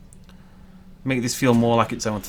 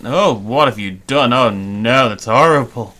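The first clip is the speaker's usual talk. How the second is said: loud, horrified oh no; dismayed outburst; 7 dB louder than usual. Real, not acted. Builder seeing a ruined spot, loud and dismayed. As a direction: groan at the mess he made.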